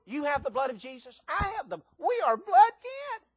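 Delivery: exultant proclamation. Real, not acted. Climax of kinship with Kevin.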